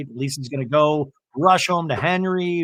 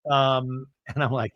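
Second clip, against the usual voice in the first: normal talking voice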